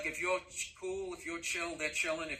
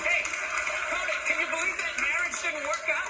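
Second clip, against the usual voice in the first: teasing taunt; 6 semitones higher than usual. Acted. Taunting Cody about his marriage.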